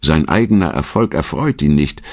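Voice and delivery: ordinary talk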